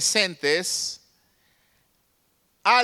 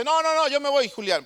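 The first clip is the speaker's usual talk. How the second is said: raised, higher protest; angry refusal; 7 semitones higher than usual. Retold or imitated. Angry worker telling Julián he is leaving, raised voice. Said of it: "Se enojó inmediatamente".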